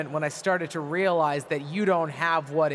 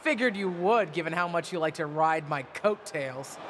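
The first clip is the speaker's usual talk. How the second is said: taunting heel promo; wrestling promo; 3 semitones higher than usual. Acted. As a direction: sneer at her like a heel. Villain promo confronting niece.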